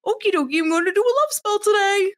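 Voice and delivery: put-on high, fast and bouncy kid voice; mock ditzy chirp; chirpy airhead. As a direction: play a cheerful clueless teen. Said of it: "rando kids being like".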